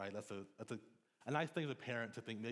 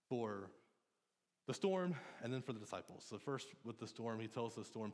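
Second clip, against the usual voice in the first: normal talking voice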